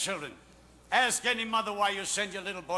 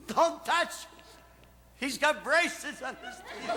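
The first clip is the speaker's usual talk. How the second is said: mock-holler at a husband; wife hollering; 6 semitones higher, slower than usual. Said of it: "my wife will holler out".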